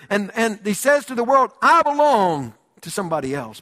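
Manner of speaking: swelling pulpit proclamation; preaching at full force. Acted. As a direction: preach it out to the congregation. Loud preaching of the verse.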